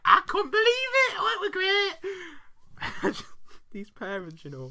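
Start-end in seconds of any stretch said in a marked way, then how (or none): 0.1-3.2 high, loud mock rapture; rave, drawing out the last phrase; gushing wife. Wife thrilled by the affair, hammed-up gushing. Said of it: "and she's like".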